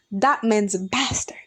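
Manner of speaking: hushed, breathy whisper of contempt; whispered insult. Retold or imitated. To best friend about the ex, whispered scornful insult. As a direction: whisper it like a dirty secret. Angry retold rant about ex.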